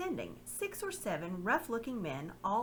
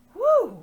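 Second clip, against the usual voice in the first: loud high whistled whew; surprised whistle; 17 dB louder than usual. Acted. The slaver marvels at the talking mouse, with a loud whistle. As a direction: whistle in greedy amazement. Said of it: "whistled the slave merchant".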